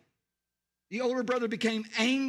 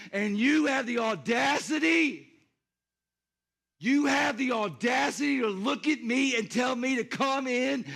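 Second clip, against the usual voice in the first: angry outburst; 3 semitones higher than usual. Acted. The older son yells at his father over the party, furious. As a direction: let it all explode, years of resentment.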